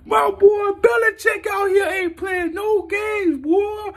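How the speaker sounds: high, slow hyped shout; excited shout. Real, not acted. Fan hyped about new signings, slow high shout. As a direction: yell it like your team just won.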